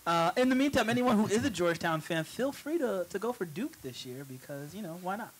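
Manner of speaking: light sports-announcer read. Acted. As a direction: announce it to the fans. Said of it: "You really sound like an announcer".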